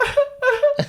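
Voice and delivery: high-pitched mock sobs; short, squeaky fake crying bursts; imitated crying. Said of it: "I'm just crying".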